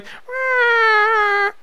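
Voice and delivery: high, drawn-out plaintive meow; crying cat meow. Retold or imitated. Mimicking his cat crying from the next room, high meow. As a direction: wail it like a lonely cat. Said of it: "start crying and going".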